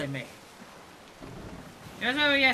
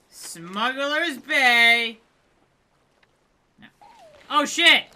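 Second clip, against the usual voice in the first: normal talking voice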